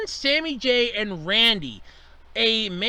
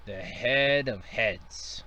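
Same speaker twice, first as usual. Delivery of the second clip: ominous boss voice; 9 semitones lower, 7 dB quieter, much slower than usual. Acted. Sketch, dramatic reveal of boss.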